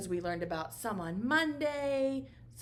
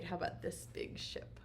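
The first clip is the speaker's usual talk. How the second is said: whisper; 3 semitones lower, 9 dB quieter than usual. Acted. Hushed lead-in to next picture.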